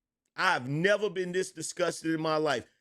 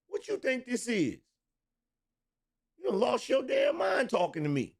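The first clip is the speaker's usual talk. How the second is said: mock outrage; 7 semitones higher than usual. Acting out the women's mentality.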